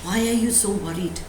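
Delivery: ordinary talk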